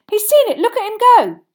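high, loud cheering cry; excited shout. A narrator's reading. Boy follows the dive from the stands, thrilled shout. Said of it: "Harry shouted".

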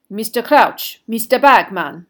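oily, emphatic address; unctuous. A narrator's reading. Headmaster appealing to the two judges, unctuously. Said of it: "his voice unctuous once more".

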